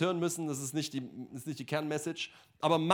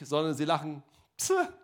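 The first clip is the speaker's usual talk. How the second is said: disbelieving pfft through the nose; scoffing laugh. Retold or imitated. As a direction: huff out a mocking little laugh. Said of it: "lachen eben nicht im Sieg".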